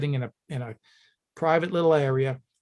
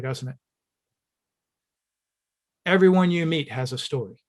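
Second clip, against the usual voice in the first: normal talking voice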